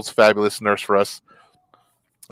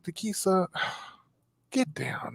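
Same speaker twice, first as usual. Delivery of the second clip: tired nurse; 7 semitones higher, 12 dB quieter, slower than usual. Retold or imitated. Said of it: "she was like".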